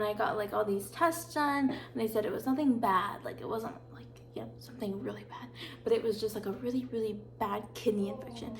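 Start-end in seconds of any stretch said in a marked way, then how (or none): 3.2-5.5 unvoiced hushed whisper; whispered aside. Real, not acted. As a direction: drop to a secretive whisper. Hushed aside about serious illness.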